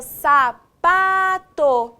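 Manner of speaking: SAPATO very slow and loud, syllable by syllable. Shown as a demonstration. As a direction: stretch out each syllable of "sapato", separated. Teacher splits word into syllables. ("sapato" bem devagar, sílaba por sílaba)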